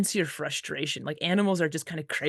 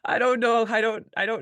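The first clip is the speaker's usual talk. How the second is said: laughing, higher and louder; 6 semitones higher, 4 dB louder than usual. Real, not acted. Musher laughing to host about dogs, amused disbelief.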